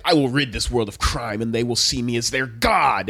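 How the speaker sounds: low, grandiose villain proclamation; low mock-megalomaniac voice; Light. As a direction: imitate a self-made god declaring his mission. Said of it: "Light's like".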